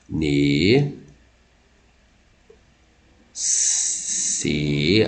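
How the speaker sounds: "Ne, se" low, drawn out, with long gaps; syllable by syllable. Shown as a demonstration. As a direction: sound out "Ne, se" slowly as separate syllables. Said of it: "você separa ela assim". (separando "Ne, se" sílaba por sílaba, grave e pausado)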